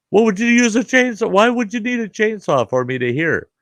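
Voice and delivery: very high-pitched, indignant retort; indignant questioning. Retold or imitated. Grandfather puzzled by the chainsaw jibe, indignant.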